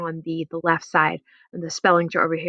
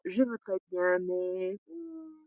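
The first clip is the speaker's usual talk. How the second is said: much softer, trailing regret; soft regretful no; 4 semitones higher, 11 dB quieter, much slower than usual. Shown as a demonstration. Teacher acts a soft regretful decline, quietly. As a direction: let it fade away apologetically.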